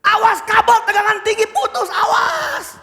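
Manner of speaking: urgent high yell, climbing on the last word; shouted warning. Acted. Worker warns of the broken cable, shouting. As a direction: scream a danger warning over noise. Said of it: "Dia teriak lagi".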